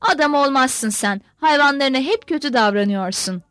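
ordinary delivery, nothing marked

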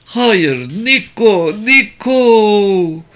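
high, loud mother's lament; acted wail of a bereaved mother; anguished wailing. A narrator's reading. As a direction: call the child's name in drawn-out grief. Mother wails over dying son.

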